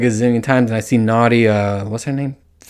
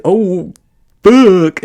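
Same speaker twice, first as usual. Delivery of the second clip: louder imitated groan; mock moan; 7 semitones higher, 7 dB louder than usual. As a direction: demo the telltale groan. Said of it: "and you say".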